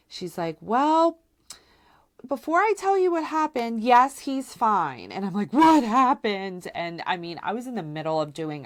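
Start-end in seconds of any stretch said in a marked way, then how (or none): 0.6-5.1 much higher, careful reassuring voice, fast then slowing on yes; sitter breaking news. Retold or imitated. Babysitter telling the mother on the phone, high and careful. 5.5-6.7 alarmed demand. Retold or imitated. Demanding news from the sitter.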